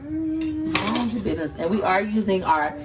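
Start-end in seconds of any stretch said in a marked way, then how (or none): 0.0-1.5 higher, slow sass; wife mocking her husband's reply; sassy mock husband. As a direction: sass it out slowly. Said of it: "He said".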